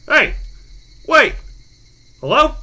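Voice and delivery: high, loud, separate cries; urgent calling out. Acted. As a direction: call frantically after a caller hanging up. Caller is hanging up.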